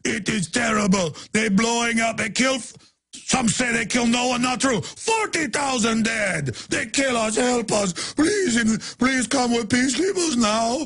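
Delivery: high, pleading mock Arabic accent; hammed-up Arabic-accented plea, like a bad actor; fake Arabic accent. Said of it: "into the fake, uh, Arabic accent".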